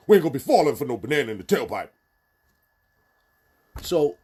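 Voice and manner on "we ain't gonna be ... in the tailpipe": louder, higher, fast tough street voice; bass in your voice. Retold or imitated. Eddie Murphy's movie line redone with bass, loud tough voice. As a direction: say it like a real brother, full force. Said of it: "put some bass in your voice".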